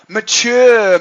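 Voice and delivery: "mature" drawn out slowly, French-tinged; accidental French accent. Real, not acted. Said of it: "Was that my French accent".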